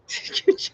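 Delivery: quick unvoiced splash noise; imitated splash. Streamer retelling getting dunked by a wave, imitated splash. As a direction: mimic water hitting with the mouth.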